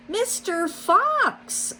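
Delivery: slow, very high pleading call. A narrator's reading. Rabbit pleading with the fox, slow and very high. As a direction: draw his name out in a desperate plea. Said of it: "Missus Rabbit pleaded".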